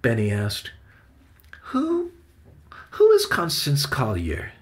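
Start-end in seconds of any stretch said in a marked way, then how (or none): 1.7-4.5 put-on high, halting young boy's question; hesitant boy. A narrator's reading. Benny asks who the woman is, hesitant and high. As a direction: ask tentatively, starting twice.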